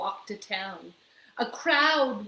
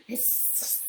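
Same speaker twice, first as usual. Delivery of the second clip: cat hiss; 11 dB quieter than usual. Acted. Cat and dog chase.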